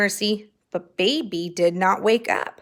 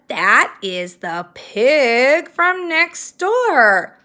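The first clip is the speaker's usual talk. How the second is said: slow, scornful correction; 3 semitones higher, 5 dB louder, much slower than usual. Acted. Eugenia corrects Baby.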